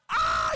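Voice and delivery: loud cry of pain; hammed-up yell of agony; imitated scream. Wife stung on both thighs, imitated scream.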